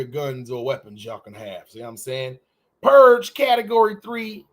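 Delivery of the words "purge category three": loud Katt Williams voice. Pretend. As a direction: announce it Katt Williams style. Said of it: "in my Cat William voice".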